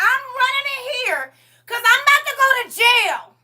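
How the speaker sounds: high, loud, begging cry of please; desperate pleading shout. Retold or imitated. To her husband at the locked door, a loud desperate plea. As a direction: beg him at full voice to leave. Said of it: "I said".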